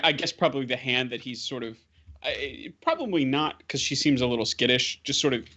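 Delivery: ordinary talk, nothing marked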